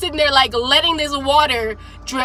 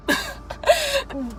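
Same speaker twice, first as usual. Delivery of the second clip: high helpless giggle; real laugh; 11 semitones higher, 8 dB quieter than usual. Real, not acted. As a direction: break up laughing mid-sentence. Laughing at her own awkward story.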